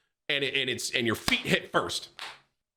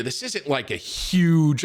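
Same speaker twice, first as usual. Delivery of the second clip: ordinary talk, nothing marked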